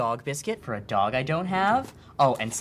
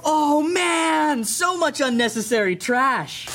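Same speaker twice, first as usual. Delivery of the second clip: excited; 11 semitones higher, 4 dB louder, much slower than usual. Acted. Gushing over junk.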